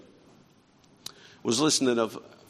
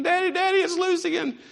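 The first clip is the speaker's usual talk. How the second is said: put-on much higher, panicked child's cry; retold child's scream; 4 dB louder than usual. Daughter shrieking to her father that the bee is loose, much higher. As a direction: squeal it out like a terrified little girl. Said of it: "started screaming again".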